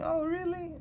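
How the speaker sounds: very high, mocking sarcasm; sarcastic. Man mocking the preacher's every claim, sarcastic. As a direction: squeak out a sarcastic mock doubt. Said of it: "like, sarcastically".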